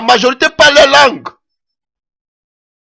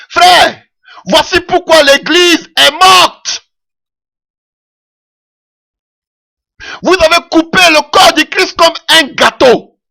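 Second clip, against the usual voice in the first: higher, louder preaching, slow on the verdict; fervent preaching; 7 semitones higher, 3 dB louder, slower than usual. Acted. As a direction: call out to the congregation like a pulpit warning. Preacher rising to full force. (prédication fervente, plus aiguë et plus forte)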